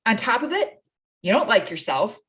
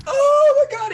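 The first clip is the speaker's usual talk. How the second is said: much higher, louder oh my God; exasperated exclaim; 6 dB louder than usual. Retold or imitated. Coach reacting to husbands who won't pay, exasperated cry. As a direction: burst out in disbelief.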